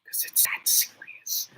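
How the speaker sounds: hushed, breathy aside; whispering. Real, not acted. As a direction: drop to a whisper. Hushed aside about seriousness.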